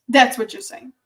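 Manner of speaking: ordinary talk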